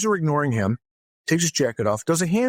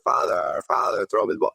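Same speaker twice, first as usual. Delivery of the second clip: whispered, lower, pitiful plea; the stranded kid calling weakly; whispered kid pleading; forlorn whisper; 7 semitones lower than usual. Retold or imitated. Poor pranked kid pleading.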